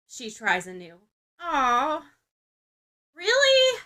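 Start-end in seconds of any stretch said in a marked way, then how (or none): 1.4-3.8 disappointed. A narrator's reading. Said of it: "Mina's disappointment shines".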